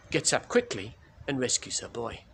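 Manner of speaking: ordinary delivery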